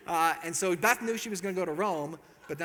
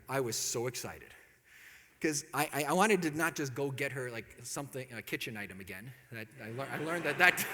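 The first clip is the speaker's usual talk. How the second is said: normal talking voice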